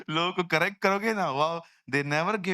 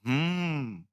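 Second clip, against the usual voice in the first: flat, curt hmm; dismissive hmm; 2 semitones lower, much slower than usual. Shown as a demonstration. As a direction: grunt it dismissively. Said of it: "बाजू वाले को बोलो". (घमंडी अंदाज़ में हम्म)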